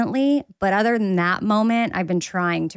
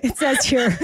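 cracking up mid-word; giggling admission; laughing; 5 semitones higher than usual. Real, not acted. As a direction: laugh at your own mistake. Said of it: "I'm not gonna lie".

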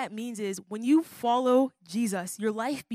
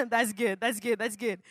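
laughing. Real, not acted.

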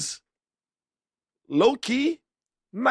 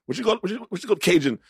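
ordinary talk

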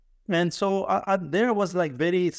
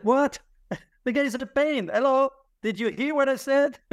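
high, laughing exasperation, rising; half-laughing outrage, much higher; indignant disbelief; 9 semitones higher than usual. Retold or imitated. Student protesting to his grandmother, high and exasperated.

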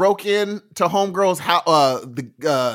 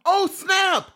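excited shout; 14 semitones higher than usual. Real, not acted. Co-host blurts it out after admitting he watched every episode, excited shout. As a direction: yelp it out, caught red-handed.

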